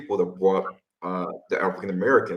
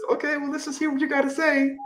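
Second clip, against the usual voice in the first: much higher, lightly mocking lady's voice; imitating interviewer; 3 dB quieter than usual. Recounting the reporter telling him to go ahead, high mock voice. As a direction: chirp it like a politely curious reporter.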